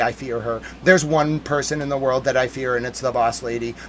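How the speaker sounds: lightly played dread; mock hushed fear. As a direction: confess in a hammed-up hush. Mock fearful confession.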